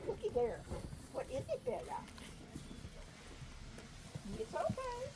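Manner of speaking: high, coaxing voice for the dog; pet talk. Real, not acted. Owner asks her upset dog what's wrong, high cooing. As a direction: coo at her to settle her.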